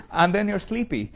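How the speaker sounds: words bubbling with laughter; laughing. Real, not acted. Teacher joking with students about sleepiness, laughing. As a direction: laugh through the punchline.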